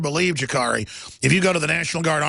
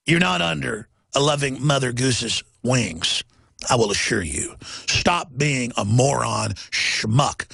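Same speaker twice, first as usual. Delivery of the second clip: lowered scolding; 5 semitones lower, slower than usual. Real, not acted. Scolding liberals as gosling.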